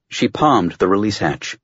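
ordinary delivery

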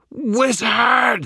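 high, loud creature shriek; monster's scream. A narrator's reading. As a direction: scream like a trapped demon. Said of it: "the thing screamed".

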